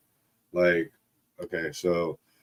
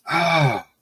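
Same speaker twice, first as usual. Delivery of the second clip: high mock yelp of pain; imitated pained "ah"; 7 semitones higher than usual. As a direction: mimic a kid wincing mid-reach. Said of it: "ahhing about".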